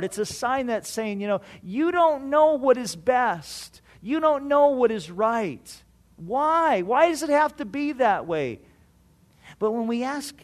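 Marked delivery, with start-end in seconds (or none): 1.6-8.6 louder, higher, resentful protest; a hard heart telling God he's wrong, louder and higher; mock indignant. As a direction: throw the complaint back at God.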